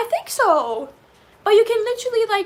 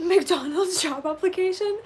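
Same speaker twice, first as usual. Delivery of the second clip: amused disbelief; 4 semitones higher, slower than usual. Real, not acted. Laughing disbelief at chat.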